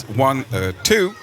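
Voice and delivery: halting Obama cadence with pauses. Shown as a demonstration. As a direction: pause like Obama mid-count. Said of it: "I've always said this with Barack Obama".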